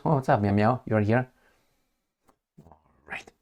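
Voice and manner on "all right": whispered aside, no voice at all; hushed whisper under the breath. Real, not acted. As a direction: drop to a breathy whisper so as not to disturb the cat. Aside while the cat interrupts.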